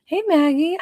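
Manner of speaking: normal talking voice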